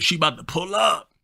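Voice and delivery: high, doubtful homie impression; friend's voice. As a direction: ask again in his high voice. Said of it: "Yeah, that's how he talked".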